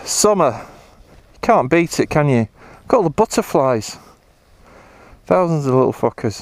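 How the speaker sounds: ordinary delivery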